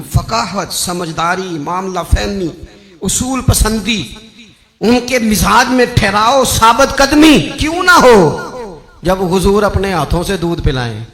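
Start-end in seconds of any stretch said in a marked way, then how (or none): 4.8-8.8 loud, slow rise to a ringing peak; fervent rhetorical climax; preaching crescendo. Real, not acted. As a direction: draw it out and proclaim it. Sermon list builds to climax.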